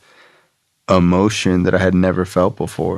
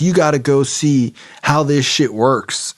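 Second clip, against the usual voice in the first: higher, slower put-on voice; insistent woman; 6 semitones higher than usual. Woman urging newcomer to the business meeting, insistent. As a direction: imitate a woman pushing a newcomer. Voices Charity pushing him.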